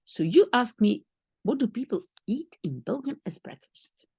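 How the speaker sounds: ordinary talk, nothing marked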